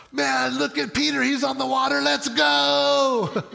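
high-pitched excited holler; rally your buddies to jump in; imagined disciple whooping them on; excited cheering. Acted.

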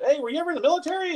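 quick, high curious question; bright quick stranger voice; imitating a stranger. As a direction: chirp the question fast and high.

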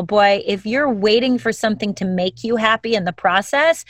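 usual speaking voice

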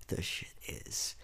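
under-the-breath whisper; whispered aside about the blackouts. Real, not acted. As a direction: drop to a secret whisper. Venting about load shedding.

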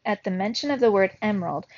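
plain reading voice

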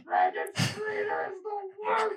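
hammed-up high sobbing; mock crying. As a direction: cry like the season ended. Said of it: "going through these phases of grief".

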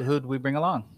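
ordinary delivery

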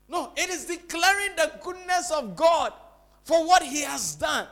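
high, loud proclamation; forceful preaching. Acted. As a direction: proclaim it emphatically to the whole church.

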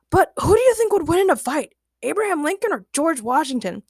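high, eager character question; Ray voice. A narrator's reading. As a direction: pose the debate in an excitable high voice.

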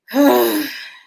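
loud exasperated exhale; heavy sigh. Real, not acted. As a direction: let out a big, dramatic sigh. Reacting to her awkward story.

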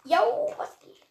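high, slow silly greeting; goofy voice. Pretend. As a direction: put on a goofy dude voice.

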